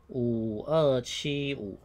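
each digit set apart, very slowly; slow spelled-out digits. Shown as a demonstration. Teacher reading the phone number to students, very slow. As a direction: dictate the number slowly to the class. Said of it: "五的话就念勾".

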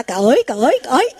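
repeated yelping cries; lightly acted startled call; imitated holler. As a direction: imitate someone crying out in fright. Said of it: "một hồi cái la".

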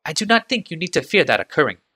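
careful servant choosing his words, pitched high; Hajar's voice. A narrator's reading. Hajar reassures the Kadir.

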